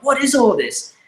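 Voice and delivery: high, puzzled mock exclamation; a retold burst of bewilderment; baffled. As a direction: sound amazed and lost. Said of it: "I was like".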